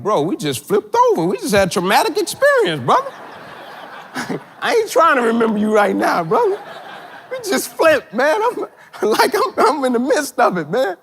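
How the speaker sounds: high-pitched comic disbelief with laughs breaking in; exasperated mock protest. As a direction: protest in your head, playing up the exasperation. Exasperated inner reply to stranger, retold.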